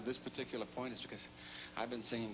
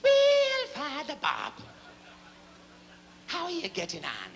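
much higher, louder Irish brogue; Irish bishop; 9 dB louder, slower than usual. Acted. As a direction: call out to Father Bob in a brogue. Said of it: "he says".

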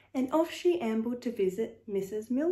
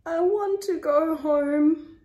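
slow, high, loud moan; 6 semitones higher, 5 dB louder than usual. A narrator's reading. Narrator voices overfed Hetty longing for home, moaning. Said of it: "she moaned".

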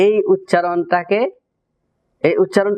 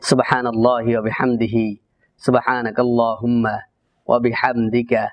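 closing prayer; 6 semitones lower than usual. Acted. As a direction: murmur the closing prayer devoutly. Closing dua. (নিচু স্বরে দোয়া পাঠ)

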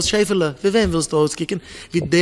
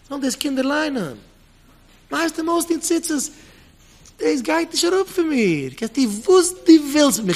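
pleading voice; 10 semitones higher, 4 dB louder than usual. Retold or imitated. The child begging the teacher, high pleading. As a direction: beg in a thin high voice.

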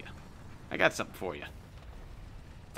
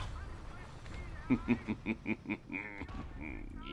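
cartoon laugh; 6 dB quieter than usual. Real, not acted. Long laugh after game over.

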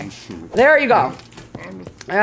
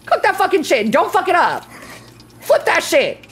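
urgent kitchen orders; 6 semitones higher than usual. Pretend. Urgently orders the cooking along.

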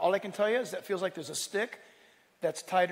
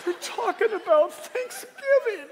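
high, slow, loud mock wail; hammed-up weeping over a church announcement; absurdly emotional, drawn-out cry; imitated overcome listener; 14 semitones higher, 7 dB louder, slower than usual. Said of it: "somebody would read the church announcement and he's like".

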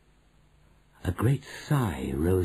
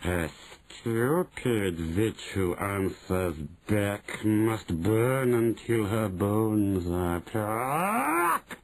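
slow cold menacing chant; chilling witch voice; much slower than usual. Acted. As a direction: intone it slowly, chilling. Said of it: "a voice that made my blood run cold".

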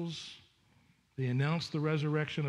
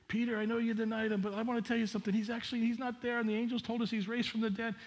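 higher, fast, breathless with news; excited woman; 7 semitones higher, faster than usual. Acted. Women running to tell Peter, higher and fast with excitement. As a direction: rush it out like you can't hold it in.

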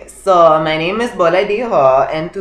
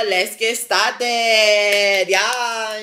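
singsong kickoff; 5 semitones higher, much slower than usual. Real, not acted. Vlogger kicking off the chat session, singsong and drawn out. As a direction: draw it out slowly, high and singsong.

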